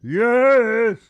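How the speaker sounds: high, loud, drawn-out answer; big simple helper. Acted. Sharn answers the commander's call, loud drawn-out reply. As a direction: call back with one long word.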